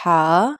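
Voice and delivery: ہا with a long breath from the throat; drawn-out, throaty ہا; letter demonstration. Shown as a demonstration. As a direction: push ہا out of the throat and hold it. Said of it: "ہا دیکھیں کس طرح سے".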